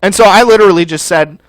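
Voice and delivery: ordinary talk, nothing marked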